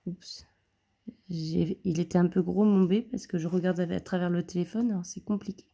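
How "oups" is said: whispered oops. Real, not acted. Slip of the pen while writing.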